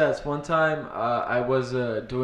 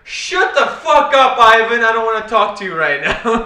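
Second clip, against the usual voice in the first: imitating a furious friend; 7 semitones higher, 7 dB louder than usual. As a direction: play the sore comic lashing out. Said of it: "He's like".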